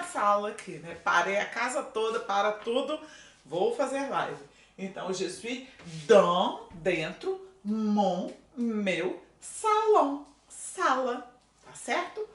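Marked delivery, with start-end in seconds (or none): 5.1-11.3 slow, very deliberate French phrase; slow teaching demo. Shown as a demonstration. Teacher spelling out a French sentence slowly for students. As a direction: lay out each French word slowly for the class. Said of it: "teacher slow demo sentence".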